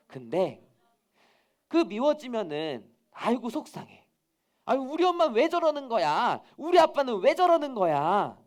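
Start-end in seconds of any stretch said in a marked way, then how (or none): 3.1-8.4 put-on higher, louder exasperated child act; annoyed complaining. Child grumbles about mom and dad, annoyed and fed up. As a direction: build from upset to loud, whiny grumbling. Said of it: "이렇게 화만 내고 짜증만 내는".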